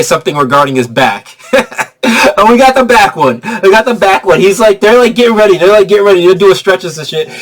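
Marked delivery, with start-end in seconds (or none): none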